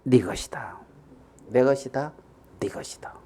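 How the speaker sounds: soft, low, hushed assurance; quiet, tender promise; reassuring father. Acted. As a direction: reassure your son gently. Father reassures his elder son.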